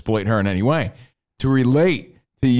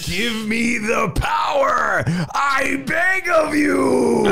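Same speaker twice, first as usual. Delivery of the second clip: raging monster; 10 semitones higher, much slower than usual. Retold or imitated. Dad losing it in the car, raging monster shout. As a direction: beg for power like a monster transforming. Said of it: "I turn into a raging monster".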